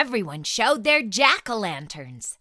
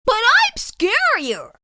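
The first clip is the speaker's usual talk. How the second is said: boastful; 7 dB louder than usual. Acted. Splat boasting.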